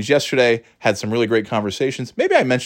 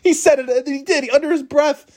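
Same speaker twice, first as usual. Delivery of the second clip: high, squeaky talk through laughter; laughing. Real, not acted. Teller amused by his priest dad's secret swearing, real helpless laughter. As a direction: barely get it out after cracking up. Cracking up at own story.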